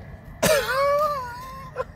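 high squeal of a laugh. Real, not acted. Man laughing as the machine eats his tenner, high squeal. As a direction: let out a high incredulous laugh.

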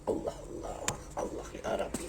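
soft breathy disbelief; exasperated whisper. Real, not acted. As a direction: sigh it out in disbelief. Exasperated at online comments. (fısıltıyla bıkkınlık)

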